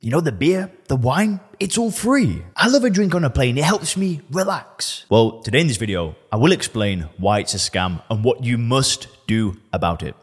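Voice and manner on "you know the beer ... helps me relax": higher, whiny voice of a drinker making excuses; mock defensive drinker. Host voicing a listener who defends free plane drinks, mock defensive and higher. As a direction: play the listener justifying their plane drinks. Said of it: "What's your initial reaction to that?".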